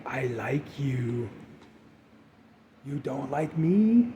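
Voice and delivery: slow, soft imitation of a horror-film girl; imitated movie girl. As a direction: draw it out quietly, quoting the creepy girl. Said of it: "you know, where she's like".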